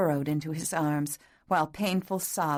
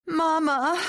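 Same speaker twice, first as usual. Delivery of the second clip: put-on high, loud childlike wail for her mother; pitiful wail; 11 semitones higher, 5 dB louder than usual. A narrator's reading. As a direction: cry out like a lost little girl. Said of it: "she wailed pitifully into the dark".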